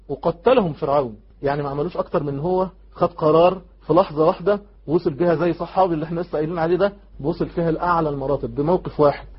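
usual speaking voice

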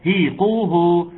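slow demo of هيقوه; equal dammas. Shown as a demonstration. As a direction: stretch the dammas in هيقوه evenly. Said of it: "تساوي زمن الضمة".

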